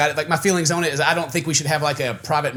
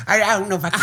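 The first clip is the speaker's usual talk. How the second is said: higher, laughing imitation of a muddled American-Australian accent; mock mixed accent; 5 semitones higher, faster than usual. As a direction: mimic a botched Australian accent. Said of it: "mixing a little bit of both there".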